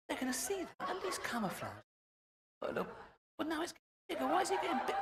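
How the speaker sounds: very high, giggling voice of a panicking soldier; squeaky mocking commando. Comic mocks commandos hiding in barbed wire, squealing high. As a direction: squeal it out, half laughing, as a flustered commando.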